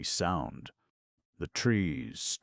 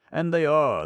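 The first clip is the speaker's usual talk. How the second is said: higher and louder, stung; loud bitter insistence; 13 semitones higher, 10 dB louder than usual. Acted. Connie insists to the gamekeeper people jeer, higher and louder. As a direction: cry it out, hurt by the jeering.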